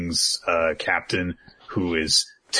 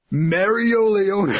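high, slow, grand announcement; dramatic announcer; 14 semitones higher, 6 dB louder, slower than usual. Retold or imitated. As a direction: proclaim the name like a herald. Grand introduction of the name.

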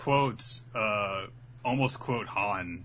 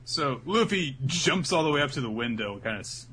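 ordinary talk

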